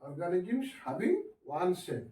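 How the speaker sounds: spelled out slowly for writing down; teacher dictating word by word to class, slowly; slow dictation. Shown as a demonstration. Teacher reads notes aloud slowly. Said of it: "teacher dictating to class".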